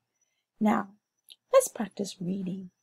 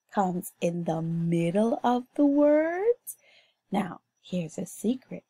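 usual speaking voice

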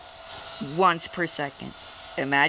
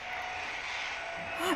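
sharp gasp; 11 semitones higher, 13 dB quieter than usual. Real, not acted. Sudden scare.

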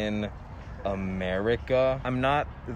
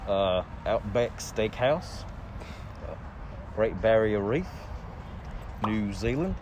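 Australian impression. Shown as a demonstration. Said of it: "Could you do an Australian impression".

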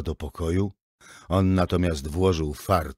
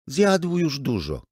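high, slow protesting plea; 9 semitones higher, 4 dB louder, slower than usual. Acted. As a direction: defend the son eagerly. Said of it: "zaprotestowała Emma". (Emma broni syna, wysoko i z naciskiem)

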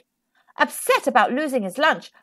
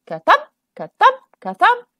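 high, bouncy thumping chant; rhythmic thump sounds; 8 semitones higher, 4 dB louder than usual. A narrator's reading. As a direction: beat out each ka-thump.

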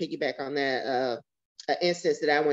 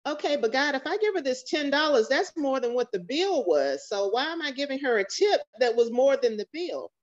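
higher, reasoning politely with God; polite pleading with God; 7 semitones higher than usual. Retold or imitated. Mother retelling how she reasoned with God over the tip, higher and polite. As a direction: lift the voice and plead your case politely. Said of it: "politely explaining to God".

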